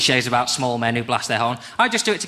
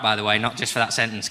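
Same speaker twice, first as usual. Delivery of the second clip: ordinary delivery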